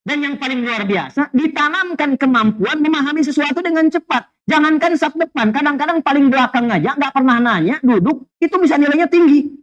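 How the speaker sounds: ordinary delivery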